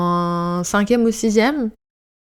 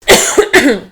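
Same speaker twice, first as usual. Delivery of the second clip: louder choking cough; choking on a drink; 4 semitones higher, 6 dB louder than usual. Real, not acted. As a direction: clear the throat after choking. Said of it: "Je m'étouffe avec mon thé".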